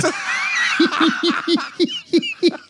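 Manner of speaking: loud high cackling laugh. Real, not acted. Friends laughing about a radio play, high laugh. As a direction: let the laugh ring high.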